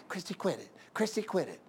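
soft, repeated scolding, kept under her breath; quietly nagging mother in church; mother's hushed scolding. Retold or imitated. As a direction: mom hushing a child in the pew. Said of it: "And the mom's going".

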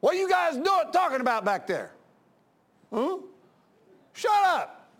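pastor shouting down chatterers in church; angry yell. Retold or imitated. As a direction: pastor yelling from the pulpit. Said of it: "and I said".

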